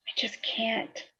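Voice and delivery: choked, barely voiced whisper. Sister at her brother's grave, whispering through tears. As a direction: whisper it like you can't go on.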